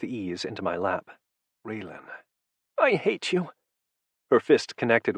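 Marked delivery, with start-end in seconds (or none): 1.6-2.1 much softer, barely voiced Raylan; hushed "Raylan" to calm her. A narrator's reading. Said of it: "I repeated with more force". 2.8-3.5 high pitched cry of hate; woman screaming her hatred, high; hate-filled. A narrator's reading. Enraged woman fighting her captor.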